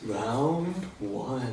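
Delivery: sexy voice. Pretend. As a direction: say it like you're flirting. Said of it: "say their round with the sexiest voice".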